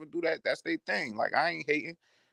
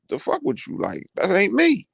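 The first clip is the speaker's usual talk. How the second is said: ordinary talk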